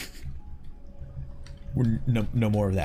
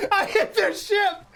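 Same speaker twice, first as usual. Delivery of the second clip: high, slow words through laughter; giggling confession; laugh-crying at the mistake; 11 semitones higher, slower than usual. Real, not acted. Laughing at own blunder.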